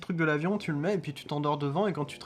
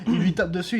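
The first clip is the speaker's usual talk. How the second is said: normal talking voice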